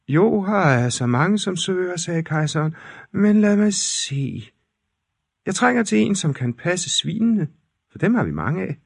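high, hammed-up emperor voice; pompous fairy-tale emperor, pitched high. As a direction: play the emperor up high and grand.

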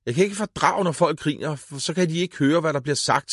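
high furious outburst; raging boy, high-pitched yell; angry scream. A narrator's reading. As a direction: scream out his rage at the laughing. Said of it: "skreg han".

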